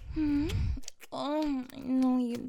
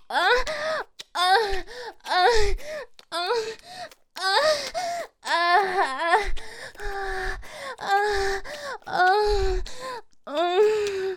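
climax moans; 6 semitones higher, much slower than usual. Acted. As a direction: let the moans peak.

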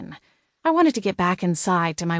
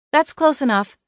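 firm, raised-pitch warning; 8 semitones higher, 4 dB louder than usual. A narrator's reading. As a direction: warn him off, voice lifted. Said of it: "I warned him".

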